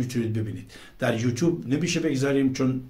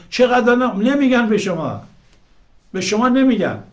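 indignant outburst; 10 semitones higher, 8 dB louder than usual. Real, not acted.

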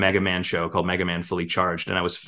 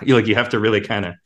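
ordinary talk, nothing marked

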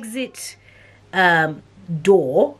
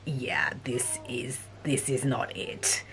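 soft, slow and low, half-whispered; quiet retold reaction; 4 semitones lower, 11 dB quieter, slower than usual. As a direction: say it under your breath, drawn out.

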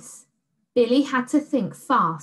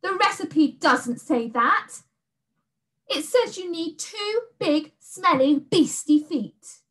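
put-on high, loud protesting girl; indignant girl; 8 semitones higher, 3 dB louder than usual. A narrator's reading. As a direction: put your foot down.

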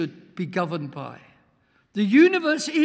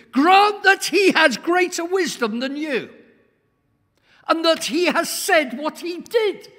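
loud, higher proclamation; fiery preaching; 8 semitones higher, 8 dB louder than usual. Acted. Preacher to congregation on God's power, booming. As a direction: thunder it from the pulpit.

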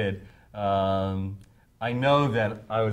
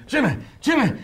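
urgent calling; 8 dB louder than usual. Retold or imitated. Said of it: "Meatloaf coming up saying".

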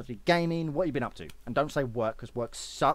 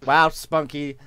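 ordinary talk

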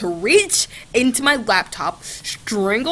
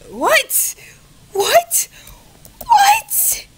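each what climbs higher and louder, ending in a yelp; escalating disbelief; 6 dB louder, much slower than usual. Real, not acted. Reading the bot's reply, what after what, rising to a shriek. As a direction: build from puzzled to shrieking.

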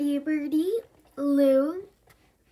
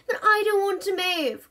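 higher, louder grumble; grumbling, raised voice; 7 semitones higher, 4 dB louder than usual. A narrator's reading. Bertie grumbles louder at Mum. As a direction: raise your voice in a sulk. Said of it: "grumbled Bertie, raising his voice".

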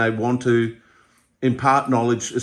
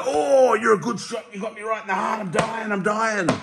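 mock dying; 11 semitones higher than usual. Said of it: "they go".

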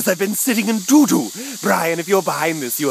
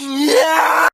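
loud, high yell of glee; big victory yell; triumphant shout; 11 semitones higher, 7 dB louder than usual. Acted. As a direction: whoop it out.

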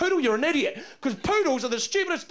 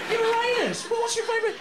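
normal talking voice

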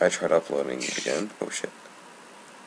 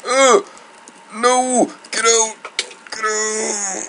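high, loud real panic yell; real startled screaming; panicked scream; 10 dB louder, much slower than usual. Real, not acted. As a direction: scream it out as the mob attacks. Attacked by angry mob.

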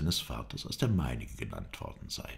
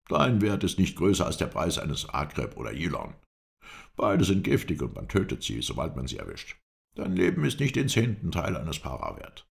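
stern captor; 3 semitones higher, 4 dB louder than usual. Acted. Captor demands ransom from his prisoner, stern and forceful. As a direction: pronounce a harsh judgment on the prisoner.

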